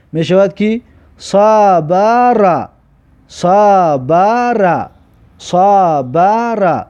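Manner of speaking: صبر stretched very slowly, each sound held; slow demo pronunciation. Shown as a demonstration. Teacher sounds out صبر very slowly for pupils. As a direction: spell out صبر slowly for learners.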